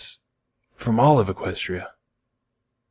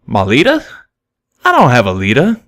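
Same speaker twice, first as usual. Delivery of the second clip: higher and louder, confused and taken aback; shocked confusion; 3 semitones higher, 4 dB louder than usual. A narrator's reading. Stallion caught off guard, answers in shocked confusion. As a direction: blurt it out, baffled. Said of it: "shocked confusion".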